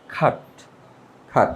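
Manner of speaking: খাট with aspirated kh and no R; British pronunciation. Shown as a demonstration. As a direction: puff the K and drop the R: খাট. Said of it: "আবার ক না". (খাট, ক নয় খ, র ছাড়া)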